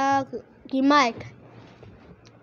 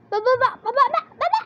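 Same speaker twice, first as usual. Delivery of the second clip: frantic urging; 11 semitones higher, 4 dB louder, faster than usual. Real, not acted. Urgent rush in game.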